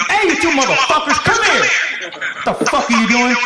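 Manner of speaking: angry sergeant. Acted. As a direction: chew out two soldiers. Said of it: "he got really angry and goes".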